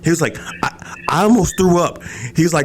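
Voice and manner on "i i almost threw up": high-pitched imitation of a freak-out; freaked-out brother. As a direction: mimic your brother's shocked complaint. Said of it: "he is freaking the fuck out".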